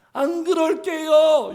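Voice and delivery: high, slow pleading; a child begging forgiveness, high and slow. Retold or imitated. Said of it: "용서를 빌어도".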